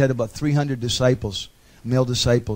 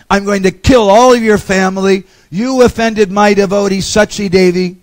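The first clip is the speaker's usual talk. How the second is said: furious yelling; 5 semitones higher, 9 dB louder than usual. Retold or imitated. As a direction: roar it down at the trembling king. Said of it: "started yelling at him".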